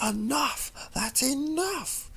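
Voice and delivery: high desperate screams; anguished shriek to make the voice go away; screaming. Acted. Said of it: "Luna screamed".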